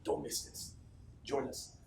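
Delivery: a whispered, urgent appeal. Real, not acted. Speaker to his team, whispering the appeal. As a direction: lean in and whisper it.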